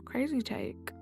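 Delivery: ordinary delivery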